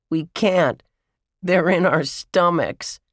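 put-on high little-boy boast; gleeful crowing. A narrator's reading. Brian admits eating Josh's cookies, gleeful crowing. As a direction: crow over your mischief. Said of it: "Brian crowed".

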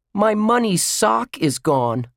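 high, slow, emphatic complaint; indignant outrage. A narrator's reading. Josh finds his money sock gone, indignant outrage. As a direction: stretch out the bad news, pitched high.

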